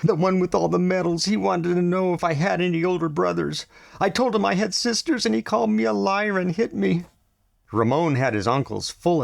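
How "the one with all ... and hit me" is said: put-on high boy's voice, struggling to compose; gasping through tears. A narrator's reading. Ramon telling his mother about the soldier, tearful. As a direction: push the words out between gasps. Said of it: "his breaths coming in gasps".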